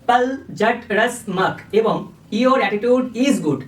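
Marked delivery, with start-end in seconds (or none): 0.1-1.6 slow, syllable by syllable: পল জট রস মক; sounding out code words. Shown as a demonstration. Teacher sounding out code words for students, slow and deliberate. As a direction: spell each code word out slowly so it sticks. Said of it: "এইভাবেই আসলে উচ্চারণ করতে হয়".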